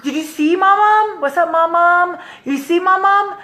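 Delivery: high, singsong call to grandma; imitated excited phone chatter. Grandson telling grandma about the trip, excited.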